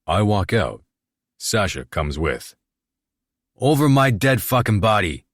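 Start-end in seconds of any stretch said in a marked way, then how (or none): 3.5-5.2 loud furious outburst; shouting. A narrator's reading. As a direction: bellow it in outrage. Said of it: "Ren spouts off".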